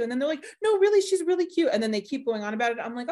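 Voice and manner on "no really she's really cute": high, louder imitated insistence; gushing stranger. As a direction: gush insistently in a put-on high voice.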